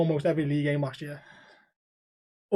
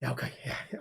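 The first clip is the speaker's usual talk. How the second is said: low, much softer mock agreement; meek compliance; 5 semitones lower, 11 dB quieter than usual. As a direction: back down quietly, imitating the lad. Lad backs down after the threat.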